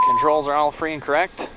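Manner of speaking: ordinary delivery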